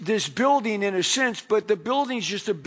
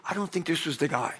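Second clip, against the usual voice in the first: quick, low, softer confiding voice; hushed aside; 4 semitones lower, 6 dB quieter, faster than usual. Retold or imitated. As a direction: imitate someone leaning in to confide sheepishly. Usher leans over to confide.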